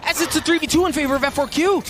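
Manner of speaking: high excited commentary; esports caster. Real, not acted. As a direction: keep the hype rolling.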